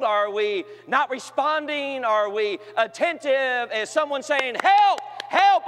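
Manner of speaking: preacher crying out for help for the youth; rising preacher appeal. Acted. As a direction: call the church to wake up. Preaching at full force.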